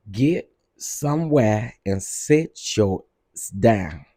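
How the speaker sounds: slowed, word by word scolding; slow, deliberate telling off. Acted. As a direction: drag it out to make the point. Scolding slowly.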